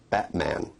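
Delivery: "Batman" with a catch in the throat for the t; glottal stop. Shown as a demonstration. Teacher showing glottal sounds, "Batman" with the t as a throat catch. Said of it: "the catch in the throat".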